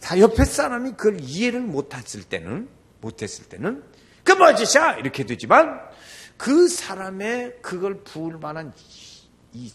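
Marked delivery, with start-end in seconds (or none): 4.2-5.0 high, loud mock outburst; indignant onlooker. Onlookers who don't understand the woman, acted indignant shout. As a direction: cry out in acted outrage.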